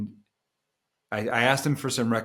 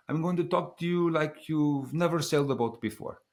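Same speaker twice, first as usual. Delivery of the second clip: put-on higher-pitched Italian accent; 6 semitones higher than usual. Patient skipper briefing an experienced sailor, imitated Italian accent. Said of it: "stop with the Italian accent".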